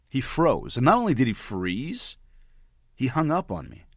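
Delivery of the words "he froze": ordinary talk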